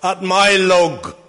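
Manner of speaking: high, loud, drawn-out angry yell; shouted outburst. Retold or imitated. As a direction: shout it out like an outraged churchgoer. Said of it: "and shouted out".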